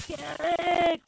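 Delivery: a strained high whisper of Garrick; whispered name. Retold or imitated. Sleep paralysis, trying to call her brother, strained whisper. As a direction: try to scream Garrick but only whisper it. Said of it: "really whispered".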